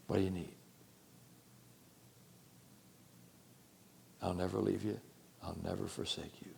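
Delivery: soft, low, reassuring voice of God; God's tender voice. Acted. God reassuring the believer he is near, soft and tender. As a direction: speak as God comforting a frightened child.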